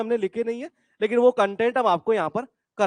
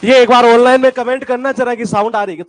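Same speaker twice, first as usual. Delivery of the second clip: loud call to the room; 3 semitones higher, 11 dB louder than usual. Real, not acted. Teacher checking sound with class.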